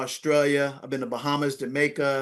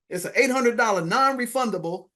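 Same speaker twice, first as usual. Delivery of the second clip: ordinary talk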